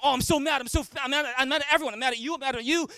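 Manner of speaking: whiny mock anger at everyone; mock mad rant. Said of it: "Rather than you just going".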